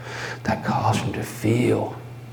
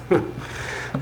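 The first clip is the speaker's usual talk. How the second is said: soft mad laugh; 4 dB quieter than usual. Acted. Mad laugh after quote.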